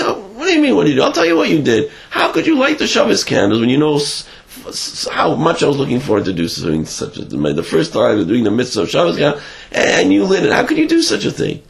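lightly voiced upset bride; a touch of a hurt, accusing wife; scolding wife.

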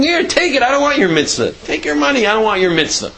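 high-pitched, insistent mock refusal; dismissive rejection. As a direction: fling the gift back at them. God imagined rejecting the mitzvah.

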